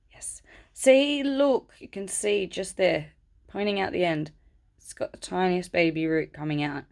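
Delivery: ordinary talk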